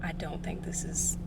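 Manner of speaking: breathy whispered aside to herself. Real, not acted. Whispering to herself about where she parked. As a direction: whisper it under your breath, unsure. Muttering to self in garage.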